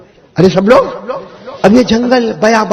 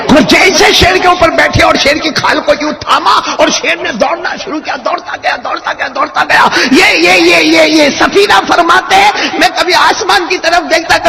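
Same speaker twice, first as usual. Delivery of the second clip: high, loud fervent storytelling; fervent sermon voice, pitch raised; impassioned preaching; 10 semitones higher, 5 dB louder than usual. Acted. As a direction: preach it to the crowd with full fire. Preaches the ride at full force.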